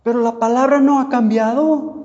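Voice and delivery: high, loud proclamation; loud preaching declaration. Acted. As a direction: ring it out over the congregation. Preacher declares over congregation.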